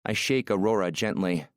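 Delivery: usual speaking voice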